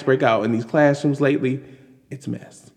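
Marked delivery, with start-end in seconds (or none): 2.1-2.7 a hushed, breathy it's a mess; dropped to a whisper, it's a mess; whispered aside. Acted.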